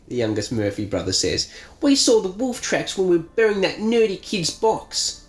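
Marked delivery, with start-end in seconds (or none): 1.8-5.2 put-on young bully voice. Youngest Murphy brother voiced.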